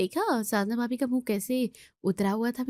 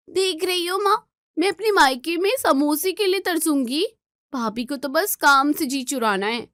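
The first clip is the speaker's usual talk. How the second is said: whiny complaint; 6 semitones higher, 3 dB louder than usual. Acted. Neelam complaining to mother.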